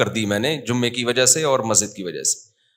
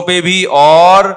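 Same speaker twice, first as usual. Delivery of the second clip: slow, louder, drawn-out words; emphatic stretch; 3 semitones higher, 8 dB louder, slower than usual. Real, not acted. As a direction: lean on it slowly and loudly. Preacher stressing spending on others. (آہستہ اور زور سے کھینچ کر)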